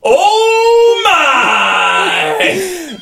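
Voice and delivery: drawn-out, high-pitched shout; high wailing exclamation; shocked cry. Acted. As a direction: cry out as something bursts through the wall. Creature bursts through the wall.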